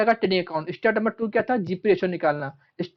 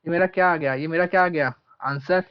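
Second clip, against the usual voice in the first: ordinary delivery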